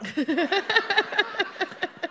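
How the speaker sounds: light high-pitched laugh. Real, not acted. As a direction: laugh at the joke. Narrator laugh.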